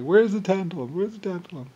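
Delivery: high, nagging mock question; mocking greedy seekers. Mocking thieves hunting tantalum, high and nagging. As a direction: pester like a greedy thief. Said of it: "It's like".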